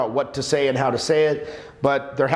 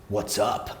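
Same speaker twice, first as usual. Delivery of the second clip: lower, softer "what's up"; low mock greeting; 6 semitones lower, 5 dB quieter than usual. Coach mocks standing head-on all the time, low greeting. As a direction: drop it low, as a caricatured intense opener. Mimicking overdone head-on approach.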